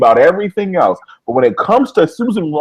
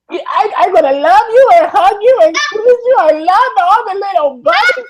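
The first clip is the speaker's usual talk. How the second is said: much higher cartoon-girl squeal, gushing; Elmira impression; 3 dB louder than usual. Cohost imitating cartoon Elmira gushing over bunnies, high squeal. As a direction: squeal like Elmira hugging a bunny. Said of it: "Susan Ross is Elmira, And she's like".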